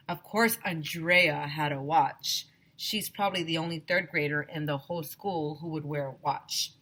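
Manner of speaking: plain reading voice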